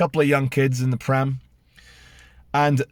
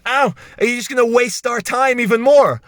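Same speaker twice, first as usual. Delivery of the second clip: whiny imitation of the security woman, a bit louder; mocking impatient staff voice; 6 semitones higher, 3 dB louder than usual. Staff member nagging him at the table, mocking whiny voice. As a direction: mimic her nagging tone. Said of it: "she goes".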